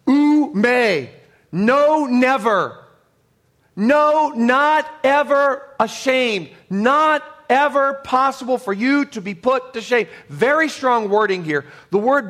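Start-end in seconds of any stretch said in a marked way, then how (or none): 0.1-10.1 high and loud, NOT stretched out slowly; emphatic preaching. Real, not acted. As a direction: hammer the double negative, drawing out "not ever". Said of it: "I guess it would be called a double negative".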